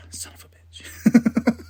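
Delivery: slow, hushed whispered swear; whispered curse. Retold or imitated. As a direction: breathe the curse under your breath. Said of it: "And Io's like".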